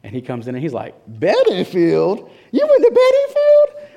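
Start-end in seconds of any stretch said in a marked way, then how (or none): 1.1-3.9 drawn-out, very high and loud amazed inmate voice; excited inmate. Retold or imitated. Inmate spotting his high school shirt, amazed and drawn out. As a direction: stretch Bedingfield out in delighted disbelief.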